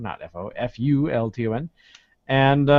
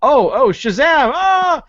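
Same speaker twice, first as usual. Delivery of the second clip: hammy excitement; 8 semitones higher, 7 dB louder, slower than usual. Pretend. Hammed-up eating scene.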